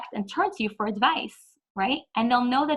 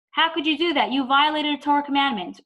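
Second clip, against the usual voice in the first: higher, louder scolding accusation; admonishing; 5 semitones higher, 4 dB louder than usual. Retold or imitated. Child rebuking father for breaking Torah, higher louder admonishment. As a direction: scold the father for his sin. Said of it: "rather than an admonishment".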